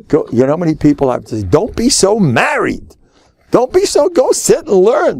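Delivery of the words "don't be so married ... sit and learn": high, exasperated mock order; mock scolding. Telling too-married men to go learn, exasperated scolding. Acted scolding of devoted husbands.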